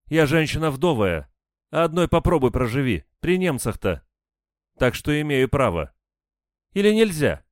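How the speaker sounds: high, loud self-justifying challenge; defiant woman. A narrator's reading. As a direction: dare them to judge you. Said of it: "с вызовом ответила Надежда". (с вызовом, высоким женским голосом)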